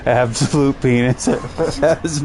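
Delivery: laughing through the words; giggling mid-sentence. Real, not acted. Laughing while talking.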